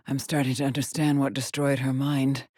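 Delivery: usual speaking voice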